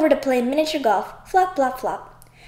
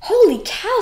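amazed; 10 semitones higher, 3 dB louder than usual. Acted. Dad amazed by hole in one.

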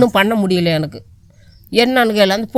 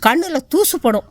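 imitating hospital staff; 6 semitones higher than usual. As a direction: lightly mimic the nurse's soothing excuse. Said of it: "அப்படின்னு சொன்னாங்க".

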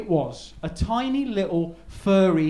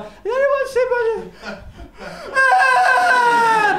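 put-on high child's voice breaking into a squeal; little girl calling; 13 semitones higher, much slower than usual. Little girl searching the park, cut off by a squeal. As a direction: play a small girl searching, then shrieking. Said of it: "she's like".

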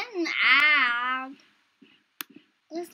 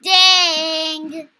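louder sharp outburst; loud frustrated dang; 14 dB louder than usual. Real, not acted. Gamer caught out, loud frustrated outburst. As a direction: blurt it out in frustration.

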